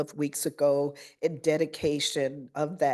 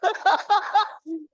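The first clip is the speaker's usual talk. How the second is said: high, relieved giggle; nervous laugh; 14 semitones higher, 5 dB louder than usual. Real, not acted. Principal laughing off her nerves after the speech, high giggle. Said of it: "I was a little nervous at the beginning".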